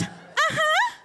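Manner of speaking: a hint of a patient who gets it; patient's uh-huh.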